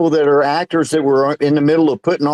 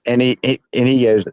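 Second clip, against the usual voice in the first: usual speaking voice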